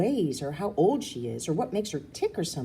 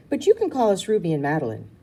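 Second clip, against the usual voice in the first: fast and a bit louder; hurried, eager correction; quickly; 8 dB louder, faster than usual. A narrator's reading. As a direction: rush it out to correct her. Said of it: "I say quickly".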